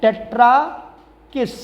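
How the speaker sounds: slow, drawn-out dictation pace for notes. Shown as a demonstration. Teacher dictating a rule to students, slow and drawn out. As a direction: spell out the rule so students can write it.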